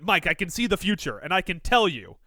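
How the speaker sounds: loud prophecy building to a shout; frenzied prophet. Acted. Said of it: "my eyes had been rolled in the back of my head".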